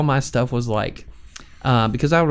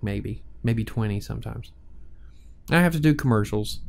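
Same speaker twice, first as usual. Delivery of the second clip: ordinary talk, nothing marked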